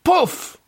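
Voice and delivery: high, sharp poof; magic poof. Acted. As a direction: pop it out like magic smoke. Genie magic in joke.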